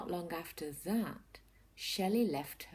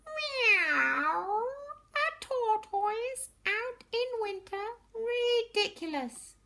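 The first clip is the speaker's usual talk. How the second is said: sneering cat; 7 dB louder, slower than usual. Acted. Cat scoffs from tree.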